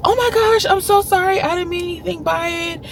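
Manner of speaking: high mock apologetic voice; imitating the lady. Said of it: "And she goes".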